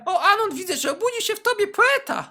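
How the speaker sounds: sing-song it like a sarcastic teacher; mock teacher. Teacher voiced in story.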